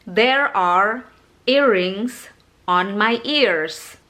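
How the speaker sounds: slow, every R in "earrings" and "ears" sounded; American clear R. Shown as a demonstration. Teacher gives an example of clear American R. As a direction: lean on each R in "There are earrings on my ears". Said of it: "Jadi R-nya itu jelas".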